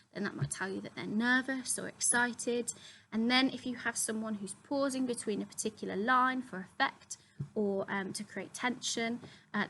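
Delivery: ordinary delivery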